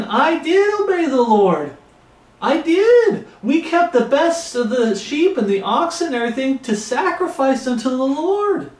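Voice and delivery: high, pleading mock whine of a self-justifying king; mocking Saul. Preacher mimicking Saul's excuses to Samuel, high mock whine. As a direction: whine it up high like you're sure you obeyed. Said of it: "Saul kept saying".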